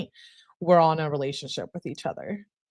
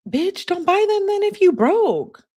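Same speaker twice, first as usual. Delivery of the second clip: very high, slow squealed scolding; exasperated, mad; 14 semitones higher, 4 dB louder than usual. Real, not acted. Reader scolding the broke girlfriend in the texts, exasperated and mad. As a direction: screech it slowly in outrage. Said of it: "I get a little mad sometimes".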